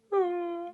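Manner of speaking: high soft acted yawn; small yawn. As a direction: give a little yawn. Spider yawns.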